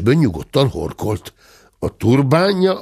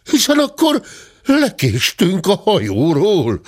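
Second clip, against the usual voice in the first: stammering; 9 semitones higher than usual. A narrator's reading. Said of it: "dadogta Dörmögő úr".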